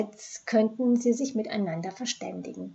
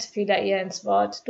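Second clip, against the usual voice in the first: ordinary delivery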